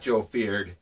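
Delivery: plain reading voice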